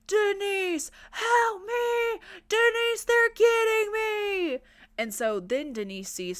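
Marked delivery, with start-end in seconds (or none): 0.1-4.6 high hammed-up call for help; mock scream for help. Chris Hardwick dragged away calling to Denise, high mock scream. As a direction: play the screaming victim, way up high. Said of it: "Chris Hardwick screaming in the distance".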